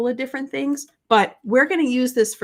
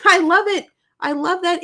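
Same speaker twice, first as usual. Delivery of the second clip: laughing delight; 9 semitones higher, 5 dB louder than usual. Real, not acted. Laughs reading viewer comments.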